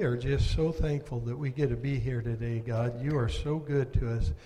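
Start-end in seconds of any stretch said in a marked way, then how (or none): none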